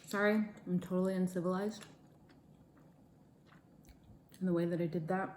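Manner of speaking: talking through a mouthful; words round a bite of food; mouth full. Real, not acted. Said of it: "the way that I'm speaking with my mouth full".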